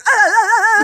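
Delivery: freeze up in panic; mock panicked stammer; frozen panic. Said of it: "I go into, like, freeze".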